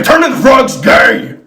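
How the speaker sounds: shouted conspiracy rant; Alex Jones. Acted. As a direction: yell it like an outraged radio host. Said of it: "He's like".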